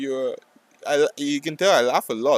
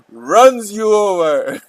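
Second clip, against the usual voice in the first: dramatic slow announcement; 9 semitones higher, 11 dB louder, much slower than usual. Acted. Dramatizing being run over.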